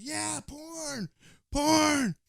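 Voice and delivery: high, loud whooping call from a passing car; cheering stranger voiced high and loud; mock stranger yell. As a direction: holler it out the window.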